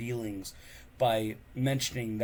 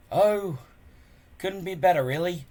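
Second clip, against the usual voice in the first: bleak; 7 semitones higher, 7 dB louder than usual. Acted. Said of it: "said Hiccup, bleakly".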